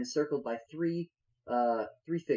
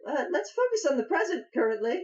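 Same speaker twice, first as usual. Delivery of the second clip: high-pitched halfling voice; halfling brushing off; 12 semitones higher than usual. Acted. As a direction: steer them back to the matter, high. Halfling answers the bard.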